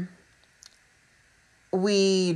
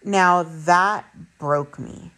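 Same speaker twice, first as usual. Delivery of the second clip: ordinary delivery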